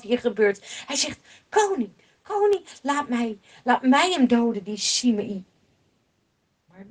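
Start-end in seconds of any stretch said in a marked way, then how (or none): 1.5-5.4 furious plea. Acted. Abisai begging King David to let him kill Shimei, furious. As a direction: burst out begging to strike. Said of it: "Abisai zo ontzettend boos".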